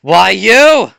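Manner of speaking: loud, high indignant outburst; angry shout. Acted. Kendo goaded by the dodging opponent, angry shout. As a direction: shout it at your taunter. Acted outburst after being taunted.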